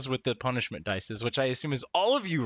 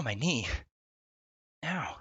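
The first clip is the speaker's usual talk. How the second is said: normal talking voice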